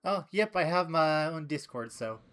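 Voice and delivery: ordinary talk, nothing marked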